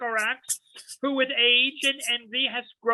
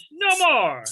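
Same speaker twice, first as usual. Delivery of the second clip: sharp, higher and louder command; stern cutoff; 5 semitones higher, 5 dB louder than usual. Acted. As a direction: shut the request down hard. Master cuts off servant's demand.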